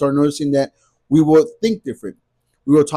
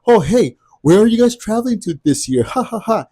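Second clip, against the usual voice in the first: higher, louder fake-friendly question with a mock ha ha ha; sarcastic jab; 7 semitones higher, 5 dB louder than usual. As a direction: imitate a sneering local, higher and louder. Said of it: "they would even make sarcastic comments like".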